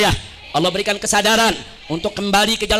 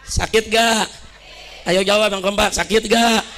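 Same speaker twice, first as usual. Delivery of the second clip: ordinary talk, nothing marked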